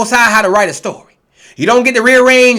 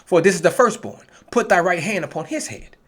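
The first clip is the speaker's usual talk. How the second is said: scripture reading; 5 semitones lower, 4 dB quieter than usual. Acted. Preacher reading Genesis to his online flock, measured reading voice. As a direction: read the verses like a preacher at the pulpit.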